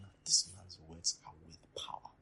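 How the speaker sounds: whispered wonder at the preacher; hushed admiring whisper; awed whisper. Retold or imitated. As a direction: whisper it, struck by his power. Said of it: "I'm like".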